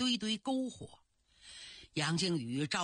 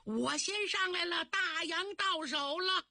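喊叫; 10 semitones higher than usual. A narrator's reading. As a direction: call out the win in a much higher voice. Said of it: "有个警察喊了一句". (高声得意地喊)

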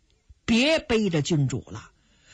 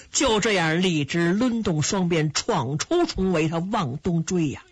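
plain reading voice

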